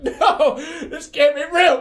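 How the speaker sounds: high, loud, laughing denial; panicked yell, half laughing; frantic shout. Retold or imitated. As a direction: shout it in disbelief. Strangling the demon.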